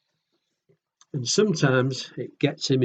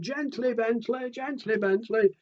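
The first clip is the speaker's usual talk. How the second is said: friends shouting; 8 semitones higher than usual. Acted. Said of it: "They're all shouting".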